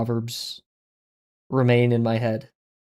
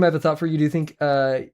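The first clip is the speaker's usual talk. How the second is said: normal talking voice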